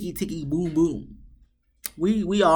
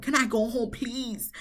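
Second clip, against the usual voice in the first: begging; 5 semitones higher, 5 dB quieter than usual. Retold or imitated. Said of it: "he's begging Michaela".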